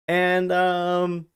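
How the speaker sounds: ordinary delivery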